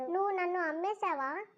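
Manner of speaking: ordinary delivery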